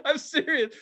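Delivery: high, slowed and broken by laughter; laughing while telling. Real, not acted. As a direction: laugh through it as you go on.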